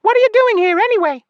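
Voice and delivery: fast, very high, loud fairy voice; irritated scolding. A narrator's reading. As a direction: squeal the question in annoyance. Annoyed fairy scolds from behind.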